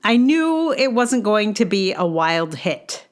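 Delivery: normal talking voice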